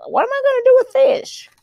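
high, fast cartoon complaint; baffled rabbit. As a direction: squeal it in a speedy little character voice.

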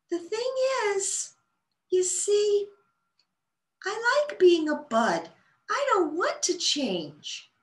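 high little voice, slow at the end. A narrator's reading. A bud telling Bella it likes being a bud, tiny high voice. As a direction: speak up a touch in the tiny bud voice. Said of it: "a bit louder".

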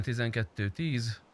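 normal talking voice

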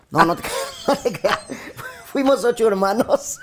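risa entre palabras; riéndose de su propia broma; riendo. Real, not acted. Se ríe de su broma. (diciendo que era broma entre risas)

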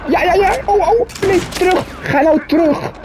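panicked. Real, not acted. Panicked game moment, high pitch.

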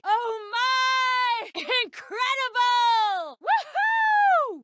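very high, drawn-out whoops of delight; exhilarated high-pitched cheer; elated cheering. Acted. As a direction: cry out in thrilled amazement. Thrilled cheering in game.

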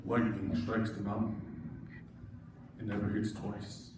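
solemn movie chief voice. Acted.

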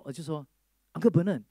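ordinary talk, nothing marked

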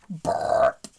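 loud imitated burp; mock burp. A narrator's reading. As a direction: burp the word out like a full dinosaur. Dinosaur burps after supper.

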